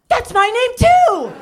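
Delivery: excited barista shriek. Retold or imitated. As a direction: squeal it like an overjoyed stranger. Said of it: "the barista goes".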